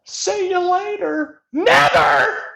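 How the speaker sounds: high, loud mock wail breaking into a laugh; mock crying turning into laughter; taunting laughter. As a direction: shout and cackle at them. Taunting cackle at fallen characters.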